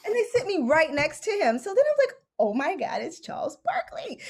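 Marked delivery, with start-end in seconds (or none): 2.4-4.2 high, breathy whisper of disbelief; hushed fangirl squeal held inside; starstruck whisper. Retold or imitated. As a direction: keep it secret but you're freaking out. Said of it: "having an entire, like, meltdown inside of me".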